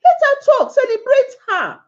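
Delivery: urgent high plea; pleading cry. Real, not acted. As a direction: cry out to let her speak. Pleading with men.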